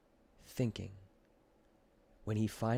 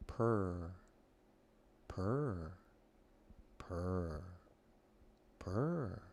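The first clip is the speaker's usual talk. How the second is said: imitated purring; 3 semitones lower, 5 dB quieter, much slower than usual. A narrator's reading. Narrator imitates cat purring.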